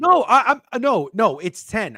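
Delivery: high, loud flustered protest; flustered denial. Real, not acted. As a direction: deny it in a fluster.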